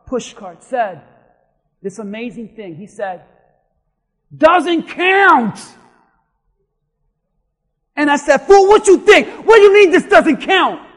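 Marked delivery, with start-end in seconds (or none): 4.4-5.6 put-on higher, louder flat refusal from an old golfer; old man objecting. Old man at golf course objects. 8.4-10.8 much higher, louder indignant shout with a laugh; hammed-up pastor losing his temper; mock angry outburst. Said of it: "And I got mad".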